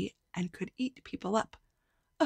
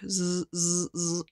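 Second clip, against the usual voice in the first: imitated saw sound; slower than usual. A narrator's reading.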